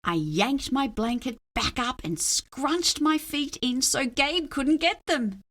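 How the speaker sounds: plain reading voice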